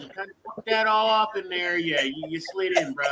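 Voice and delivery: normal talking voice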